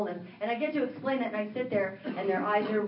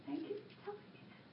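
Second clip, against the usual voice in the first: weeping; 10 semitones higher, 15 dB quieter than usual. Retold or imitated. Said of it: "And he's weeping".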